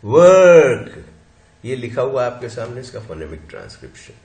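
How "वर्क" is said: वर्क loud, with its middle vowel drawn out; long middle vowel. Shown as a demonstration. Teacher showing the length in the middle, drawn-out demonstration. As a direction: stretch the vowel in वर्क, slowly. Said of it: "वर्क में लंबा ह आता है बीच में".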